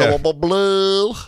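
slow put-on slurred voice; slow, mushy mock impression; mock impression of her voice; imitating her speech. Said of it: "She talks like this".

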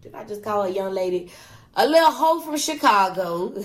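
ordinary talk, nothing marked

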